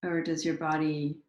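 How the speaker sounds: unhurried meditation leader, pausing after each prompt; guided meditation. Acted. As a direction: guide listeners gently into their bodies, leaving space between phrases.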